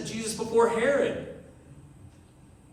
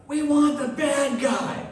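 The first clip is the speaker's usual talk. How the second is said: slow, louder, higher crowd yell; crowd shout; 8 semitones higher, 4 dB louder than usual. Retold or imitated. Mob shouting at Pilate to free Barabbas, slow loud yell. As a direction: bellow it slowly as the mob.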